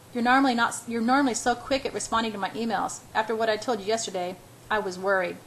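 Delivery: ordinary delivery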